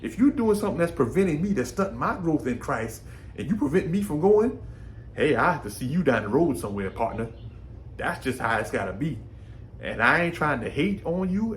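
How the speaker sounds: ordinary talk, nothing marked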